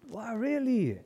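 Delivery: high, amazed wow; light up like a thrilled kid; childlike amazement. Retold or imitated. Young boy amazed at mom's answer, high and thrilled.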